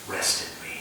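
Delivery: soft, hushed voice of the Lord; comforting divine voice. Retold or imitated. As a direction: breathe it gently, like comfort from above. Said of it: "warming and comforting voice".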